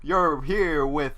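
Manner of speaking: big, loud radio announcer, vowels stretched long; Tom Kenny announcer voice. Acted. Jake opening the school morning show, classic announcer voice. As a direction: belt it out high and slow like a zany morning-show host. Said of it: "in classic Tom Kenny announcer voice".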